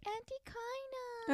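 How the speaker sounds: put-on high, slow sweet little-kid call; toddler voice. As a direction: coo it like a tiny child. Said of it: "when he was like".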